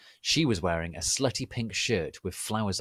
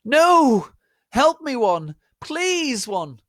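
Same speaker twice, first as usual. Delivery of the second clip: much higher, loud panicked pleading; terrified scream; 12 dB louder, much slower than usual. Acted. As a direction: shriek for help in terror. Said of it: "Brittany screamed terrifiedly".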